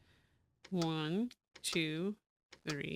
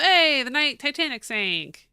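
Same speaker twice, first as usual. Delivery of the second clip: louder, sudden burst of excitement; blurting out an excited recognition; 8 semitones higher, 12 dB louder than usual. Real, not acted. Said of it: "why did I get excited about that".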